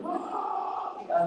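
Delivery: high mock battle yell; war-game yell. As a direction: imitate a war-game soldier's cry. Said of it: "where you're like".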